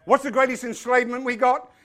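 normal talking voice

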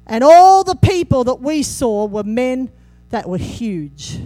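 loud, fervent preaching. Acted. Pastor preaching to the church, loud and fervent. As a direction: preach it hard to the congregation.

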